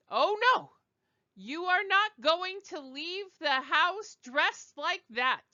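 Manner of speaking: shrill high outraged parent, loud; mother scolding her teenage daughter over a short skirt, outraged high voice. Shown as a demonstration. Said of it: "I'm going to speak to her in French".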